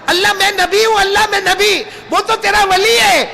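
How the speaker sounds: high pleading cry to God; a prophet weeping, asking why the saint is favoured. Acted. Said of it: "رونے لگے". (روتے ہوئے فریاد)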